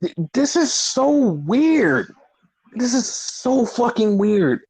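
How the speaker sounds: high, slow, drawn-out bewilderment; baffled disbelief. Real, not acted. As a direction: stretch the words out in amazement. Baffled at the booking.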